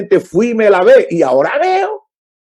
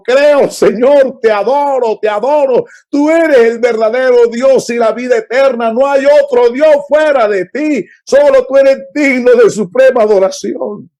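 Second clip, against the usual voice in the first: fervent worship; 7 semitones higher, 3 dB louder than usual. Acted. Healed man confesses faith to Jesus, fervent and raised. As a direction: pour out faith like a man just given sight.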